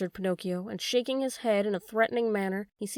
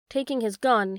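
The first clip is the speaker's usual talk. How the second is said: usual speaking voice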